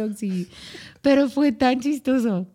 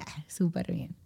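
much softer whispered delight; 5 semitones lower, 11 dB quieter than usual. Retold or imitated. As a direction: whisper it to yourself, pleased.

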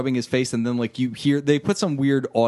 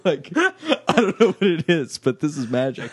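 laughing; 4 semitones higher than usual. Real, not acted. Laughing at silly banter.

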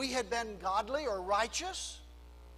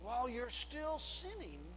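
tender God voice; 10 dB quieter than usual. Acted. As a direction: hush it down and speak like God consoling a sinner. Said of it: "God says".

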